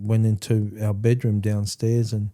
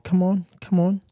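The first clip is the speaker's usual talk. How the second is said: mild retold taunt, pitched much higher; challenging taunt; 8 semitones higher than usual. Son daring his father to fight, higher retold taunt. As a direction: goad him to get up, half-raised.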